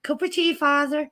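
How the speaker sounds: Irish housekeeper. Acted. Housekeeper offering the priest tea, fussy and eager. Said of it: "Father Ted".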